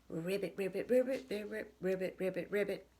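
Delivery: quick tongue-twister croaking; fast ribbits. Shown as a demonstration. Reader showing kids a fast ribbit chain, rapid and loud. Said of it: "It's not easy to say fast".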